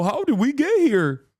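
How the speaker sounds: high, drawn-out mock lament; slow, swooping, hammed-up cry; mock bewilderment. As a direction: imitate a clueless victim wailing in dismay. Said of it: "you will sit there and go".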